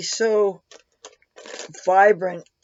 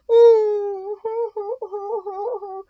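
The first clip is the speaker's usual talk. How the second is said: high fake crying wail; mock wail; 12 semitones higher, 4 dB louder than usual. Owner pretending the cat broke her heart, fake wail. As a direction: wail like a hammed-up sob. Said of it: "I'm gonna cry".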